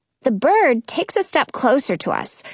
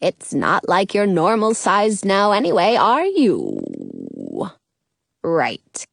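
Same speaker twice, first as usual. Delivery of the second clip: caterpillar voice; 5 semitones lower than usual. A narrator's reading. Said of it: "the caterpillar says".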